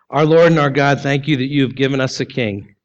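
low, reverent prayer voice. Acted. Closing prayer.

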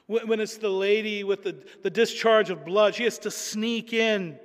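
usual speaking voice

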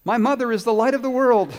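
high, loud proclamation; loud boy's voice. Retold or imitated. Little boy delivering his botched line on stage, loud voice. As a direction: belt it out like a proud child. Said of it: "in a loud voice said".

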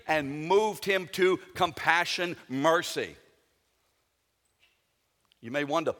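ordinary delivery

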